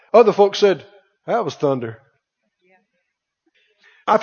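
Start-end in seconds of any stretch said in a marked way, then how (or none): 1.3-2.0 low, soft, offhand dismissal; dismissive skeptic. Acted. Other folks explaining away God's voice, dismissive. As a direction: shrug it off quietly, voice dropped low.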